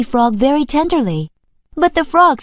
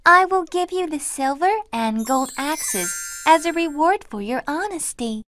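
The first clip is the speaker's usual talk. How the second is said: giggling sprite; 5 semitones higher, 6 dB quieter than usual. Acted. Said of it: "Still giggling".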